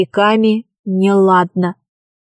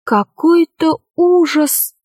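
higher, slow exclamation of horror; вслух от ужаса; 6 semitones higher, slower than usual. A narrator's reading. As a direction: say it aloud, slowly, appalled. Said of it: "сказала Алиса вслух". (вслух, протяжно, с ужасом)

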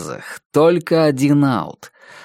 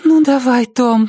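high little girl's plea, voiced rather than whispered; whispered urging; 11 semitones higher, 4 dB louder than usual. A narrator's reading. Girl urging her baseball hero on, high pleading voice. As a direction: urge him on in a high girlish voice. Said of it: "прошептала она".

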